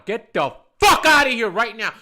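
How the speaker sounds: loud, furious blast on the curse; screamed order. Retold or imitated. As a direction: scream someone out of the bar. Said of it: "watching me scream at a woman".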